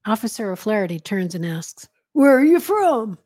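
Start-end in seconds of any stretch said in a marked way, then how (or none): 2.1-3.2 slow, loud, higher officer's demand; gruff New York cop. A narrator's reading. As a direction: bark the question like a New York policeman. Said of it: "gruff voice".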